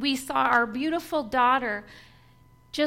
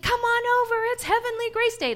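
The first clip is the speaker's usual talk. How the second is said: high, loud cheerful call; 11 semitones higher, 5 dB louder than usual. Retold or imitated. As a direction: shout it across the yard, high and loud. Daughter calling out on Halloween.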